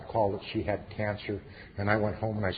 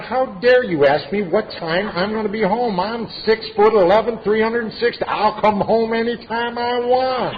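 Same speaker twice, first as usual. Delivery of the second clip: loud and much higher, indignant; defiant inner retort; 12 semitones higher, 11 dB louder than usual. Retold or imitated. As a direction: fire it back like an outraged giant. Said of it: "I thought".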